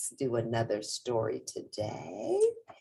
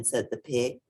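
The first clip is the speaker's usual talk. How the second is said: usual speaking voice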